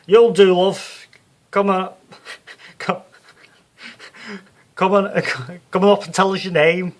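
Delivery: loud, high Liverpool showwoman hushing the hall; Psychic Sue on stage. Acted. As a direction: work the crowd like a brassy Scouse medium. Sue picks a volunteer.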